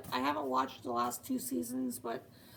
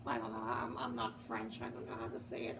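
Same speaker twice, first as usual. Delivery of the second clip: normal talking voice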